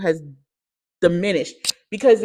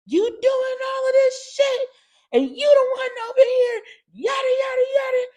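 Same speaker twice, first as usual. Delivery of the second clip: angry yelling at mom; 5 dB louder than usual. Retold or imitated. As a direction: scream at her with a breaking voice. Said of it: "I was just so mad".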